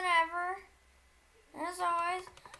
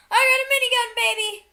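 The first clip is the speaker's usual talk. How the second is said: high, loud gloating cheer; excited shout; 4 semitones higher, 7 dB louder, faster than usual. Real, not acted. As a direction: crow about your new toy. Gets a new weapon.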